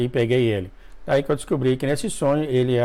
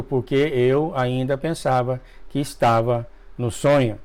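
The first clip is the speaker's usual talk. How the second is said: ordinary delivery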